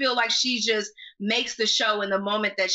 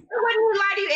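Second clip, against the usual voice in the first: emphatic echo; 11 semitones higher, 3 dB louder than usual. Real, not acted. Echoing co-host emphatically.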